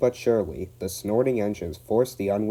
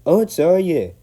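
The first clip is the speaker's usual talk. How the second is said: loud, high Scottish burst; Scottish outburst; 10 semitones higher, 11 dB louder than usual. Acted. Donald bursting out at the van, loud Scottish. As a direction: blurt it out in a Scottish voice. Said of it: "Donald burst out".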